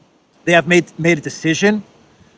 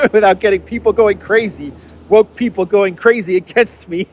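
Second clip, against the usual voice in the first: higher-pitched, chuckling through the words; laughing; 5 semitones higher, 4 dB louder than usual. Real, not acted. Host joking that people will go crazy at him, laughing. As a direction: let real laughter lift the voice. Laughs about upsetting listeners.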